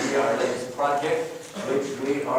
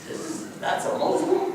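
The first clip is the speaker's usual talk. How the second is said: shocked disbelief. Retold or imitated. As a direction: gasp it out in astonishment. Shocked on first sight.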